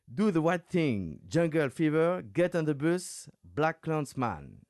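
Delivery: slow, high, careful English film titles; English accent. Real, not acted. Host reading Spike Lee titles to guest, in careful English. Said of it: "excuse mon accent anglais".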